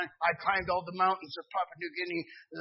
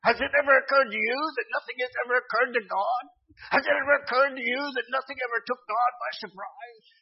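fast, preaching quote, pitched higher and louder; retelling Brother Lester Roloff's sermon; 5 semitones higher, 6 dB louder than usual. Retold or imitated. Preacher quoting Brother Lester Roloff's message to the congregation, fast and loud. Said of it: "in his message, he said".

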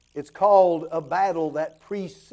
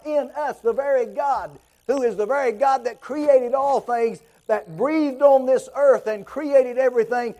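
higher and louder, ringing climax of a sermon; full-force preaching; 5 semitones higher, 4 dB louder than usual. Acted. Preacher crying out to the church about the Spirit, full-force preaching. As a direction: preach it out, climbing in fervor.

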